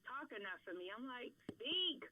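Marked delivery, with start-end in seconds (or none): 1.6-2.1 loud, high retold order; exasperated command. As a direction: call it out like an impatient demand. Tells what she'd tell shy Marcus.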